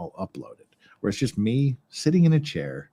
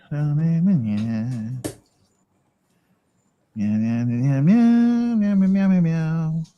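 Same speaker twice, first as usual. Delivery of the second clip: high, sing-song meowing cat; cat meows; 5 semitones higher, 4 dB louder, much slower than usual. Pretend. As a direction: meow like a playful cat, swooping up high.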